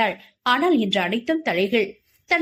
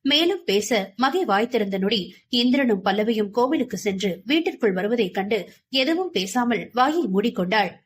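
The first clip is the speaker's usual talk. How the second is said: ordinary delivery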